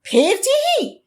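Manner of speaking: high, short whinny of protest; protesting neigh. A narrator's reading. Old horse protests the third stumble, high short whinny. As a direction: start a complaining neigh, cut off. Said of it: "ঘোড়ার প্রতিবাদের সুরে বলতে চাচ্ছিল".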